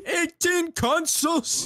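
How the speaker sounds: high, slow laughing brag; hype setup brag. Retold or imitated. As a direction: drag it out mocking the brag. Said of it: "explaining his setup".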